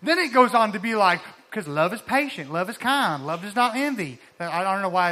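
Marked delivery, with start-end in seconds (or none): 1.5-4.2 Southern accent. Shown as a demonstration. Preacher slipping into a Southern accent quoting Scripture. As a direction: lean into a Southern accent for the verse. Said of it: "I do, like, the Southern thing when I do that".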